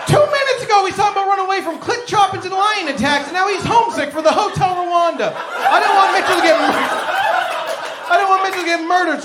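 high-pitched, shouted outburst; incredulous shout. Acted. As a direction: rant in high-pitched disbelief. Outraged outburst at driver's complaint.

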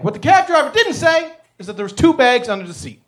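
ordinary talk

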